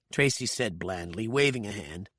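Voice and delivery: plain reading voice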